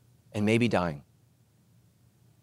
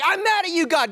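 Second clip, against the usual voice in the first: whiny complaint; 10 semitones higher than usual. Retold or imitated. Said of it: "some of you are like".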